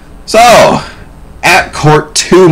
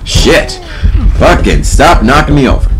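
ordinary talk

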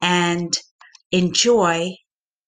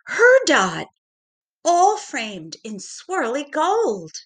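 animated narration; 6 semitones higher than usual. A narrator's reading. Excited storybook narration.

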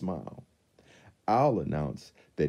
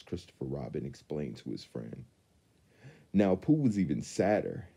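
usual speaking voice

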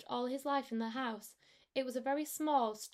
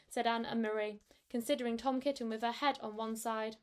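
plain reading voice

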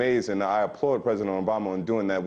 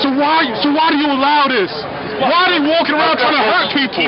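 furious shouting; yelling at police in anger; calm turning angry; 11 dB louder than usual. Real, not acted.

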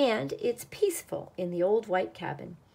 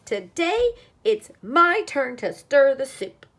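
higher, louder, proud little duck announcing; eager announcement; 6 semitones higher, 8 dB louder than usual. A narrator's reading. Duck telling friends it is his turn, eager announcement. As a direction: announce it loudly, full of self-importance.

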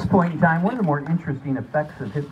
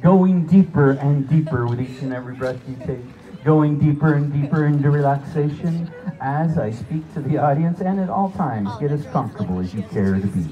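hypnotic induction; 5 dB louder than usual. Acted.

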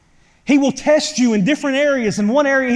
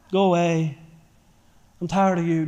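weak get out; 4 semitones lower, 3 dB quieter than usual. Shown as a demonstration. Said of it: "you can't just be like".

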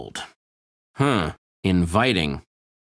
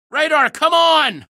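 shout; 6 dB louder, slower than usual. Acted. Calling Radar to follow.

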